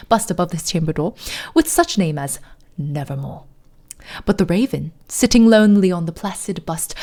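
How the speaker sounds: poem recited in put-on British; hammed-up British poetry reading; fake British accent. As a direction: read dramatic verse in a mock British accent. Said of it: "middle of a fake British accent".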